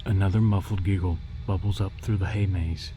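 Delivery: plain reading voice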